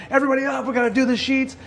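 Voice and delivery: high-pitched mock yell; retold yelling. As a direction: act out a dad rousing the house, not at full volume. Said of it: "I'm screaming and I'm yelling".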